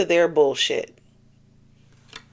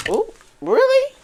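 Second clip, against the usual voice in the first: sudden very high squeal of surprise; surprised ooh. Real, not acted. Reader surprised by the card she drew, high squeal. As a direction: gasp it out, delighted.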